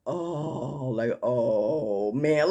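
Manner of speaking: low, drawn-out "oh" of dismay; crowd's groan. Retold or imitated. Audience reacting to her ten single years, imitated disappointed groan. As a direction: imitate a room groaning in judgment. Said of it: "I could hear them, like, saying".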